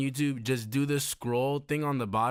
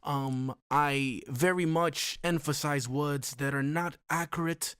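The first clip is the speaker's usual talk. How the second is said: British accent attempt; 2 semitones higher, slower than usual. Explaining wife's coaching, in a slow British accent. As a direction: stretch every word in a put-on British accent. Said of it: "trying to get a British accent".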